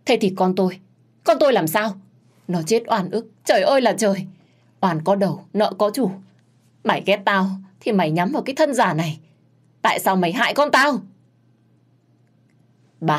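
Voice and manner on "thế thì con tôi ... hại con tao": higher, louder wailing accusation; grieving fury. Acted. Grieving mother accuses the maid, wailing fury. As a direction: lament and accuse at once.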